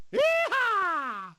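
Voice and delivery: a high, far-carrying cowboy whoop. Acted. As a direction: let out a wild yee-haw. Whooping while sliding down.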